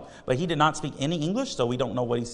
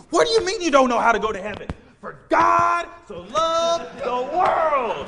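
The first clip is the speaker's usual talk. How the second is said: higher and louder, rushing then dragging the verse; crazed shouting; 11 semitones higher, 3 dB louder than usual. Retold or imitated. Gregory runs up and yells the verse at the girl. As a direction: burst in yelling the verse.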